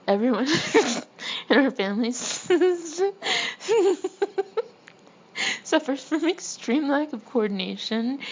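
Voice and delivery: words broken up by real laughter; cracking up. Real, not acted. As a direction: push the sentence out between giggles. Cracks up over family clumsiness.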